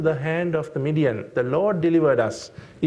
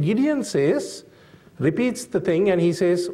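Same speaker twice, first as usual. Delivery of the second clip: normal talking voice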